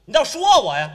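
mock indignant outburst.

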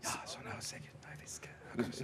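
a full, breathy whisper on request. Shown as a demonstration. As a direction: whisper it, no voice at all. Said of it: "Hvisk i vei".

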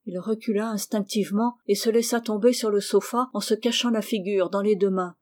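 usual speaking voice

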